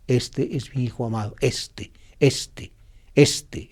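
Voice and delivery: "este" repeated, falling to a near whisper; stress on este. Shown as a demonstration. Preacher driving home the word "este" for listeners. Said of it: "el énfasis está en el este".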